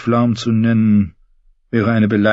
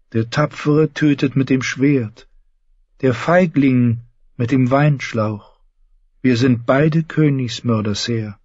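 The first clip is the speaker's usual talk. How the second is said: put-on higher, slow, devout young knight; Lancel; 7 semitones higher, slower than usual. Lancel preaching to one-handed James, slow and devout. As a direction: preach the gods' gifts gently.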